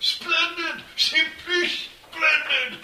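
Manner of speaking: put-on old lord roaring to another lord; delighted roar. A narrator's reading. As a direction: bellow your approval like a tipsy noble. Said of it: "one old lord roar to another".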